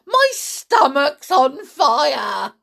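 loud, slow, high cry of alarm; shouting. A narrator's reading. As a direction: yell it out, stretching each word. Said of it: "she shouted suddenly".

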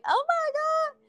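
squeaky, very high wail of dismay; mock the crying girl's despair in a tiny high voice; hammed-up falsetto "oh my God" over a bad haircut; upset girl. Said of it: "you're crying and you're thinking".

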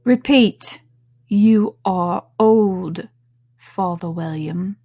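slow, loud commanding caterpillar. A narrator's reading. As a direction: order her to recite.

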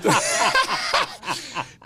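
high, loud burst of laughter; big laugh. Real, not acted. Pastor laughing at his own story in the interview, high and loud.

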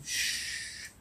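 breathy shushing, barely voiced; soothing whispered shush; hushing whisper. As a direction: whisper to calm the baby. Hushing the baby again.

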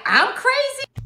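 high, slow, drawn-out disbelief; mock-shocked echo thrown back at him; incredulous retort. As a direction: stretch it out, outraged she'd be called crazy. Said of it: "I'm like".

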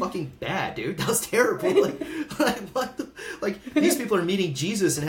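high, laughing outburst; laughing disbelief. Retold or imitated. Telling the shaman how bad it was, laughing. Recounting awful ceremony to shaman.

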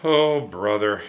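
loud, slow and fed up; exasperated sigh. A narrator's reading. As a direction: groan it out, tired of it all. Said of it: "Jack sighed".

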